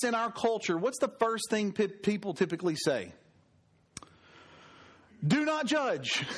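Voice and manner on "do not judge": higher-pitched indignant quote; mock protest. Preacher mimics what people typically say, in mock protest. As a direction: throw it out like a stock objection.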